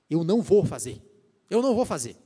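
loud, firm vow of refusal. Pretend. Believer resisting temptation to God, loud firm refusal. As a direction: plant your feet and refuse. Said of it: "você fala".

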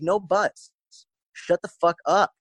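high-pitched, firm dismissal; scolding cut-off. Retold or imitated. Cuts off client's excuse.

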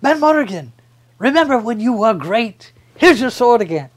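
high, loud, pleading hero voice; Willow voice. Acted. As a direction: raise the pitch and beg him. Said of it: "And Willow Hofgood says".

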